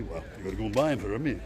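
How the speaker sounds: quick, low Rotherham accent imitation. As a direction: rattle it off in a light Rotherham accent. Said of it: "It's from Rotherham".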